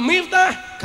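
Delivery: loud, drawn-out calling of the name; Allah admonishing. Acted. God reminding Miftah who made him viral, stern admonishing. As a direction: call the name high and sternly. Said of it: "kata Allah".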